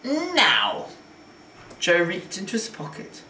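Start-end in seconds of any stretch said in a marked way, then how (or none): 0.0-0.9 high, falling, drawn "now"; insistent, menacing command; bullying demand. A narrator's reading. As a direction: order it like an impatient thug. Bully repeats demand for money.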